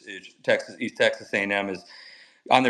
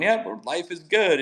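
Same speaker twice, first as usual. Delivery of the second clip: happy and carefree; 8 semitones higher, slower than usual. Retold or imitated. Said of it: "and I'm happy going".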